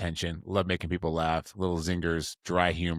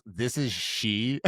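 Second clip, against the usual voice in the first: slow, drawn-out imitation of the date; imitated phone answer; much slower than usual. Date answering his phone call, slow imitated voice. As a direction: stretch it out like a prim phone greeting. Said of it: "And this voice goes".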